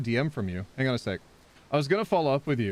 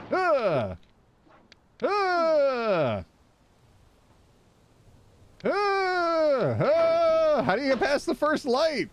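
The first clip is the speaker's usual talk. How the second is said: frustrated shout; much slower than usual. Real, not acted. Streamer yells as she falls in the game, frustrated shout. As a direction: yell out as the climb fails.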